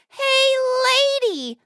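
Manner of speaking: high, drawn-out parrot squawk. Acted. Parrot hailing a passing lady, high squawking voice. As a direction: call out like a cheeky parrot. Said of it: "parrot says".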